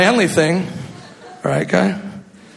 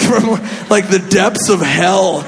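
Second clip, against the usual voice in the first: normal talking voice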